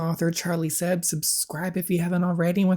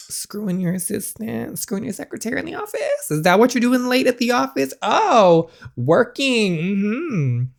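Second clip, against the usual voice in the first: higher, mocking accusation; sarcastic accusation; 8 semitones higher than usual. [name] accusing Sawyer of cheating, mocking. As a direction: needle him about the office.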